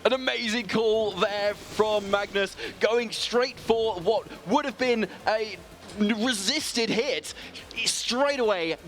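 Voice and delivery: high, slow, drawn-out excited praise; impressed live commentary. Real, not acted. Co-caster praising Magnus's call, excited and drawn out. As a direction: savour the amazing call, stretching each word.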